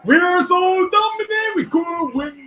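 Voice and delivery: high, slow, triumphant yell; excited shout. Real, not acted. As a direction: crow about dominating the race. Cheering own driver on track.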